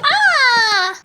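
startled cry. Acted.

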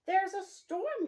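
storybook hare crying out; worried cry. Acted. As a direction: cry out the warning like an anxious hare. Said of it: "cries Hare".

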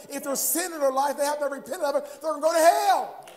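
rising fervour, pushing each line harder; preaching at full force. Acted. Pastor warning churchgoers about sin, climbing to a loud preaching cry.

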